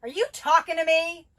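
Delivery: loud, high confrontational yell, stress on you; angry shout. Shown as a demonstration. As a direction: challenge them, shouting.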